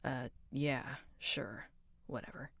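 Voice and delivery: breathy whisper fading to nothing; whispered whatever. Acted. Sulky character brushing off a question in a whisper. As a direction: barely voice the brush-off. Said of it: "retake against mumble".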